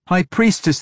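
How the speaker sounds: put-on high, alarmed call from a young priestess; frantic high-pitched call; urgent cry. A narrator's reading. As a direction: cry out to a superior, pitched well up. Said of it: "the younger woman cried".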